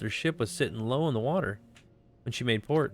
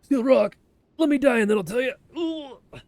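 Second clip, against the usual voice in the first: dying pirate voice; 12 semitones higher, 7 dB louder than usual. Acted. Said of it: "He's like".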